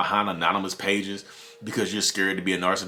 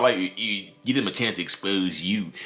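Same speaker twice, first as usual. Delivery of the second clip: normal talking voice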